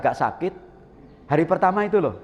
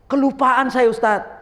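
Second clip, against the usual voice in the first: high, louder voice of a sheepish worshipper; sheepish confession; 8 semitones higher, 5 dB louder than usual. Retold or imitated. A worshipper admits to the ustadz he forgot, hammed-up sheepish voice. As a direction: confess it to the ustadz with mock embarrassment.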